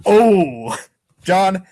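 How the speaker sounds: ordinary delivery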